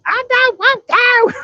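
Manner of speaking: high, slow whiny Arkansas drawl; whiny mock voice. Mocking the trucker who refuses to move, whiny high voice. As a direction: squeak it out like a sulking trucker. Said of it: "all you could hear is".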